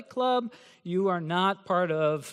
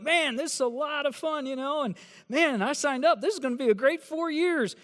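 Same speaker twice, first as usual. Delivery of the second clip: higher-pitched, gushing mock freshman; naive freshman; 6 semitones higher than usual. Professor mimics a freshman loving campus life, higher and gushing. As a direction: play the starry-eyed new student.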